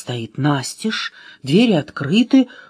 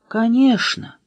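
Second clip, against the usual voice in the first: plain reading voice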